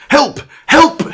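high, loud cry for help; storybook yell of panic; shouted plea. Acted. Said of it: "shouted the man".

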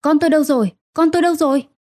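high, desperate wailing question; frantic cry. Acted. Mother wakes after surgery, cries out for baby, frantic. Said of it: "Tôi gào lên".

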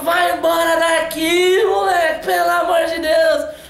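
put-on exasperated old man telling the kid to get lost; angry scolding. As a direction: drag it out like a fed-up grandpa chasing the boy off. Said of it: "ele xingou tanto esse moleque".

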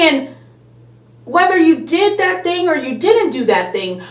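ordinary delivery

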